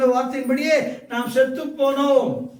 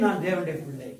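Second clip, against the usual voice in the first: lower, sincere confession; addressed to God; 8 semitones lower, 7 dB quieter than usual. Shown as a demonstration. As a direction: say it earnestly towards the Lord. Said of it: "கர்த்தரை நோக்கி". (கர்த்தரை நோக்கி தாழ்ந்த குரலில்)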